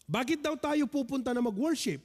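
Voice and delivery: ordinary talk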